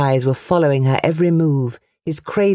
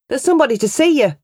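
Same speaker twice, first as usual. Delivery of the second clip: a much higher, impatient woman's voice; sharp mother; 11 semitones higher, faster than usual. A narrator's reading. Mother snapping back at her brother, sharp woman's voice. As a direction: demand an answer.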